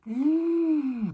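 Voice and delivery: loud, high mock swoon; high swooning ooh. Comic swooning over tall actors, high loud ooh.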